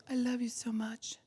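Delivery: soft whisper of sudden affection; mock whisper swinging from divorce to love. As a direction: breathe it like a tender make-up line.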